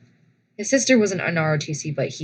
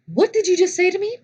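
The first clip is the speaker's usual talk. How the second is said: sharp, much higher, fast indignant challenge; livid; 12 semitones higher, 4 dB louder, faster than usual. Retold or imitated. Confronting the cadet who mocked her, high fast outrage. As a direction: demand it back, fast and high. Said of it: "I was livid".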